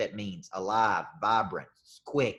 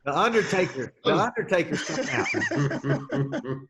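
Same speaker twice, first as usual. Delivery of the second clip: mock wrestling announcer; 5 semitones higher, 5 dB louder, slower than usual. Joking about a morbid approach.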